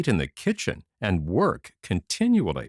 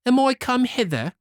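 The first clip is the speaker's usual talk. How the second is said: much higher, haughty retort; proud and saucy; 4 dB louder than usual. Acted. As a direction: sneer at the lady. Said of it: "said the proud saucy one".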